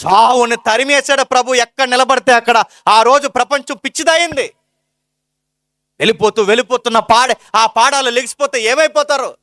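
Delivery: sermon at full force; fervent preaching. Acted. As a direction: preach it out to the congregation.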